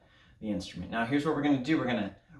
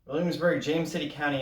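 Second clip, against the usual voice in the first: big, high hello to the class; loud greeting; 3 semitones higher, 4 dB louder than usual. Real, not acted. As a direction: call out a bright hello. Band teacher greets class.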